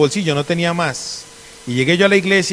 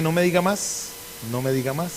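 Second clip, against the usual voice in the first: soft, sly retold urging; hushed eager reply; 8 dB quieter than usual. Preacher replaying his reply to the driver, hushed and eager. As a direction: say it softly, as if under the breath. Retells himself egging on donor.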